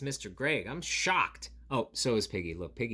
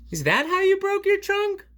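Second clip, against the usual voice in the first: much higher, louder piggy question; 9 dB louder than usual. Acted. Piggy asks Gerald, high squeaky voice. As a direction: ask it in a high squeaky pig voice. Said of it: "Piggy asks".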